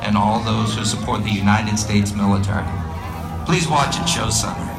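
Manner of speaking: live announcer over the PA; airshow commentator. Real, not acted. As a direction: call the show to the crowd.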